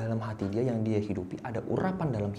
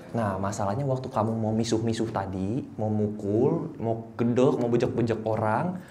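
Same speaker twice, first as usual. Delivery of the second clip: ordinary delivery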